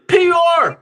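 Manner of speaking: sudden loud bark, pitch up; shouted accusation. Real, not acted. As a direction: spit it out as a shout. Fan shouts his cynical verdict.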